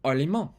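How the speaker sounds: "allemand" with a nasal ending and silent d; French pronunciation. Shown as a demonstration. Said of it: "In French".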